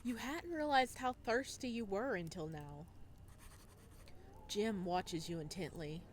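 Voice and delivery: calm, measured storytelling voice; story narration. A narrator's reading. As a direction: read it like an audiobook narrator.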